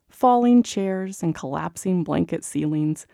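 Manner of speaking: usual speaking voice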